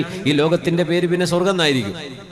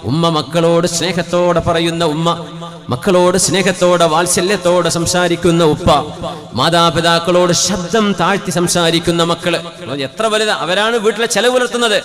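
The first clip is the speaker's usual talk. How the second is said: loud, higher, half-sung sermon chant; preaching at full force; 4 semitones higher, 7 dB louder than usual. Acted. Preacher to the congregation on loving homes, loud sung preaching. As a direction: pour it out like a fervent preacher, drawing out the Arabic. Long fervent preaching run.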